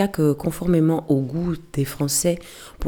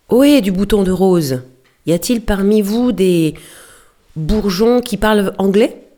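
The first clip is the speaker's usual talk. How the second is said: loud hail from a boat, voice raised high; yelling across ships; 4 semitones higher, 5 dB louder than usual. Acted. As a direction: call out to a far deck. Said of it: "il hurla". (crier fort pour héler un navire)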